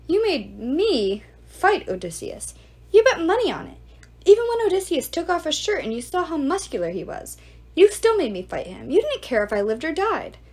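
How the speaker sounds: a hint of a wronged beggar; indignant accusation. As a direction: throw the blame back at him. Iros accuses Antinous.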